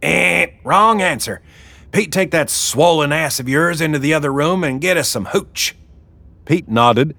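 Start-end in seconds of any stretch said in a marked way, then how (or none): 0.0-0.5 high game-show wrong-answer buzzer; loud buzzer imitation. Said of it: "made a loud buzzer sound". 0.6-5.8 high-pitched boss woman giving orders; bossy Wilma. Acted. Wilma orders Pete about.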